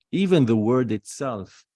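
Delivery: ordinary talk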